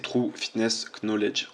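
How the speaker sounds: slow, careful English accent from a French speaker; a French speaker's clumsy English accent; bad English accent. Real, not acted. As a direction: mouth the English name in a poor English accent. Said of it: "j'ai un accent anglais pourri".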